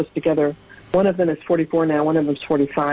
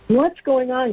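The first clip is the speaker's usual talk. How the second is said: higher-pitched mock bewilderment; baffled husband; 7 semitones higher, slower than usual. Husband answering his daughter about the shared dream, baffled. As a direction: act out a startled demand for answers.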